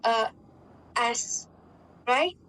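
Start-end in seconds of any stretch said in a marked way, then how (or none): 1.0-1.5 "S" said as the rural Punjabi form of "ice"; rural Punjabi pronunciation. Shown as a demonstration. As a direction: give "S" as one short word, "ass", with no glide in the vowel. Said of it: "So they say, like".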